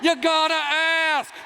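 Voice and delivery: high-pitched, emphatic vindication; triumphant exclamation. Pretend. Comic proving man logic to the audience, high and triumphant. Triumphant answer for the guy version.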